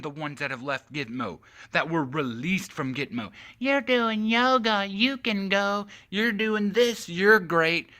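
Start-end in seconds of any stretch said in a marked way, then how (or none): 3.6-7.9 much higher, slow sarcastic voice; sarcastic high mock praise; mock official. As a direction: mimic a gushing official with scorn. Mocking release of Gitmo prisoners.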